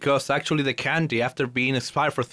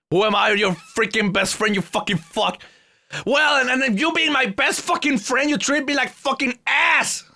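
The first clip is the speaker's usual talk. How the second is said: high-pitched indignant outburst, climbing; mock screaming tirade; 8 semitones higher than usual. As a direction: build a hammed-up offended tirade. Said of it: "I've been screaming too much".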